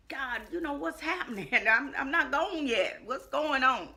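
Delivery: agitated plea. Retold or imitated. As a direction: plead upward in agitated disbelief. Frantic questioning of God, retold.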